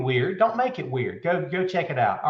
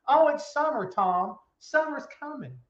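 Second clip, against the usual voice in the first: mocking quote; 9 semitones higher than usual. Mocking people's weather claims.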